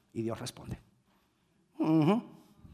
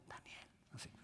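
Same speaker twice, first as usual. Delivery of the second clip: much softer, grieving call; sad voice; 26 dB quieter than usual. Shown as a demonstration. Preacher demonstrating the king's sad call. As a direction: barely voice the name in sorrow. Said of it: "así, ponga voz triste".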